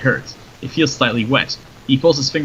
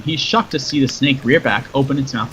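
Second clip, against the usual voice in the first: plain reading voice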